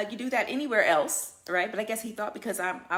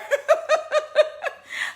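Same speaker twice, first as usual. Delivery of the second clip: bursting into a loud, high laugh; 6 dB louder than usual. Real, not acted. Vlogger laughing at her own airline story, a loud burst. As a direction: cracks up at the memory.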